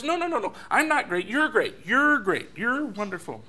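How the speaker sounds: high, insistent counter-praise; protest, then pile on the compliments; gushing groom. Acted. Groom answering bride in love battle: insistent gushing protest.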